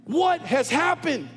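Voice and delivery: high, loud climactic preaching; preaching at full force. Acted. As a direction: thunder the rebuke at the congregation. Sermon climax, Nathan rebukes David.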